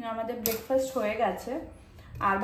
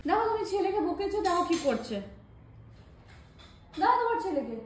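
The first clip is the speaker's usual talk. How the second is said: higher, teasing tell-off; playful complaint; 8 semitones higher than usual. Real, not acted. Mother tells husband to look at his son, higher playful scolding.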